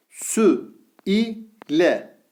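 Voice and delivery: Vü said with thin Ü, each syllable drawn out; syllable drill with thin vowel. Shown as a demonstration. Teacher reads the thin-vowel syllables to students, slowly. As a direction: read out each syllable apart. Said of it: "Sin ince harf".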